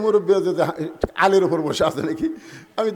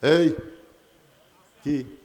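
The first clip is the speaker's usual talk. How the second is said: usual speaking voice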